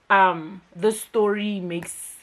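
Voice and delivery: usual speaking voice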